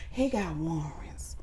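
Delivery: hushed, slow whisper. Acted. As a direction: lean in and whisper it. Aside about the president.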